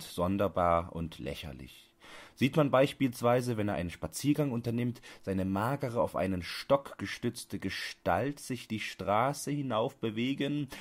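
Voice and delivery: ordinary delivery, nothing marked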